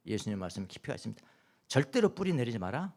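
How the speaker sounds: ordinary talk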